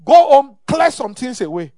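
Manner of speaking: a sudden shouted order; loud command. Real, not acted. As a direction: bark it at them. Preacher commanding congregation.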